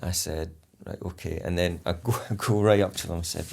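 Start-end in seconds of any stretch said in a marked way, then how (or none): 0.8-1.4 quiet and calm. Retold or imitated. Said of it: "calmed it back down".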